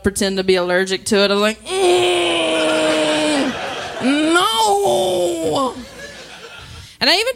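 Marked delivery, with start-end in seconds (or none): 1.6-5.7 high, drawn-out whine of refusal; mock refusal. Comedian recalls refusing the landlady's broom, high drawn-out whine. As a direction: stretch the refusal into a comic high moan.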